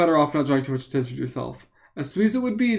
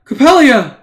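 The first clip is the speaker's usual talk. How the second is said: put-on loud, high call for a child; raised voice, searching for someone; 7 semitones higher, 10 dB louder, slower than usual. A narrator's reading. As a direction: call out for her in alarm, pitch leaping up. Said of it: "you call out".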